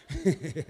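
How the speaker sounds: low chuckle. Real, not acted. Amused at shocked man.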